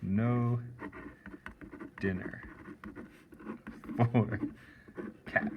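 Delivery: slow, with long gaps between words; syllable by syllable. Shown as a demonstration. Teacher spelling out the haiku's last line slowly. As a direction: space out each syllable. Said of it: "So I'm gonna say".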